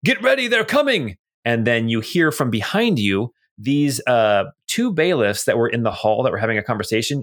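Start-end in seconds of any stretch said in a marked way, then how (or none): 0.0-1.2 high-pitched warning yell. Acted. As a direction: call out a warning from below. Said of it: "somebody downstairs yell out".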